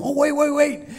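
put-on high, eager kid voice; the boy suddenly remembering; excited little boy. Boy stopping mom, high and excited.

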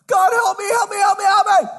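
high, desperate cries for help; panicked yelling for help. Acted. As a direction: scream up the cliff like a man dangling. Hanging man begs God.

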